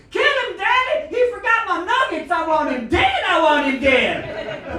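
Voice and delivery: put-on high-pitched, full-force shriek of an enraged child; bloodthirsty kid screaming. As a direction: scream it like a kid demanding revenge. Kids egging on violent dad.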